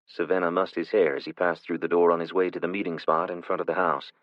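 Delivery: plain reading voice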